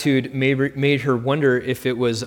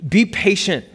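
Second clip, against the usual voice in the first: a short, curt mock snap at the child; mild snap; 8 semitones higher, 4 dB louder than usual. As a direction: cut her off sharply but lightly. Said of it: "Maya snapped at her daughter".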